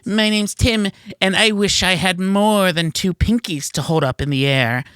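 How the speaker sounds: put-on high, prissy fancy-boy voice; squeaky high mocking dandy; Tim impression. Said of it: "here's my impression of Tim".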